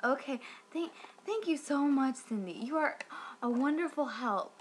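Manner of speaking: sarcastic. Retold or imitated. Cat owner thanks the useless hotline rep, heavily sarcastic. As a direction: thank them with exaggerated false enthusiasm.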